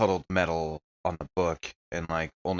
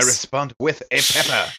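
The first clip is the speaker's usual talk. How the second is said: excited reaction; 6 semitones higher, 5 dB louder than usual. Real, not acted. Player reacts during monster assault.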